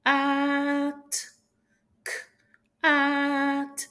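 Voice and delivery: drawn-out Cat on a high pitch; slow sounding out. Shown as a demonstration. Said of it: "do the sound for the cat slowly".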